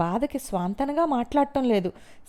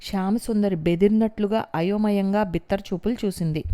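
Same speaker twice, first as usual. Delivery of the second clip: ordinary delivery, nothing marked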